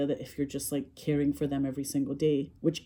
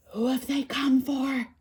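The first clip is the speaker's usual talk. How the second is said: slow, higher dramatic trailer line; ominous cinema-advert question; movie advert voiceover; 7 semitones higher, slower than usual. Retold or imitated. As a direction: intone it slowly like a film advert.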